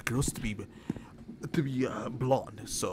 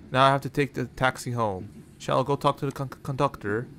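ordinary talk

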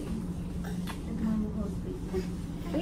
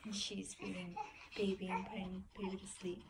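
soft whispered narration; whispering over a crib; 6 semitones lower, 14 dB quieter than usual. Real, not acted. Whispering while baby sleeps.

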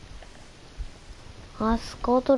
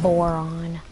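lower, different voice on the same word; goofy put-on voice; 4 semitones lower than usual. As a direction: say it again as a silly character.

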